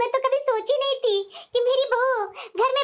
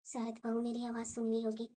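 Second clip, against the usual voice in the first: much softer, lower hopeful aside; hushed aside; 9 semitones lower, 16 dB quieter than usual. Acted. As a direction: say it to yourself so nobody hears. (धीमे से खुद से)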